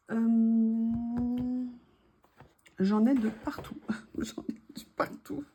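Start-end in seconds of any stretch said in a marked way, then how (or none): 4.0-5.5 breathy laughing whisper. Real, not acted. Repeats the phrase with a laugh.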